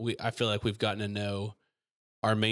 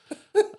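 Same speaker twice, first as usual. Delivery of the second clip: high burst of laughter; 6 dB louder than usual. Real, not acted. Laughs after impression.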